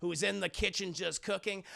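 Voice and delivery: ordinary talk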